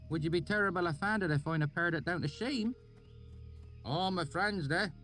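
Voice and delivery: high rustic country voice; oily backwoods dialect; thick valley accent. A narrator's reading. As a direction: swineherd musing in a thick rural accent. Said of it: "he grinned at me".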